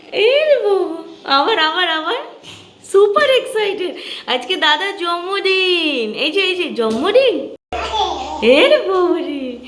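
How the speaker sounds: high, slow, singsong thrill. Real, not acted. Mother gushes to toddler about brother's birthday, high and singsong.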